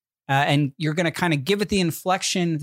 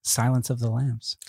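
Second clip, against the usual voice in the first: whisper; 4 semitones lower than usual. Real, not acted. Revealing the movie title.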